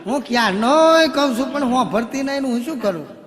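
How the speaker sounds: high, loud, laughing exclamation; excited, laughing relief. Acted. Husband to the crowd, excited and laughing. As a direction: burst out with it, half laughing. Husband delighted, excusing himself.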